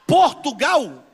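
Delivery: loud, high surprised call; surprised shout. Real, not acted. Pastor hears a visitor came from Portugal, shouting in surprise. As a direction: blurt it out in astonishment.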